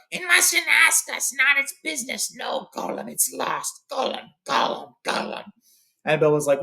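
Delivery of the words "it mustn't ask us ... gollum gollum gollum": high, slow, hissing Gollum voice; high strained whispering creature voice, possessive and desperate. Acted. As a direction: shriek it like a wretched cave creature. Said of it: "Gollum shrieks out".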